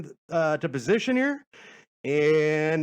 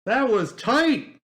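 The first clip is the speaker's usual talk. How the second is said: excited; 8 semitones higher, 6 dB louder, much slower than usual. Real, not acted. After the song ends, thrilled.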